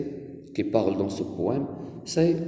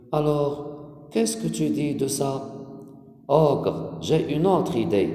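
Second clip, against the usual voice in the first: higher, measured poem recitation; expressive classroom reading with pauses between lines; 4 semitones higher than usual. Acted. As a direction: read it out to a class, line by line. Reads the poem aloud to pupils.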